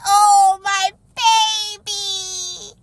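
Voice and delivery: high, slow, close to crying; tearful. Real, not acted. Mother tearfully pitying her crying baby, high and slow. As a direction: stretch it out, near tears. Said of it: "I was like".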